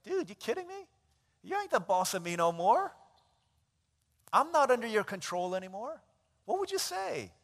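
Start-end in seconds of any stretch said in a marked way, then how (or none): 0.0-6.0 high, loud, cocky pushback; defiant refusal. Acted. Freed worker defying the old boss, loud and cocky. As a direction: tell him off, unafraid. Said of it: "Or would you be like".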